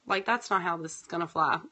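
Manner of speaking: ordinary delivery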